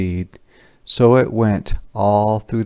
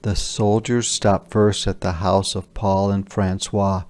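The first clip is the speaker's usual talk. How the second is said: plain reading voice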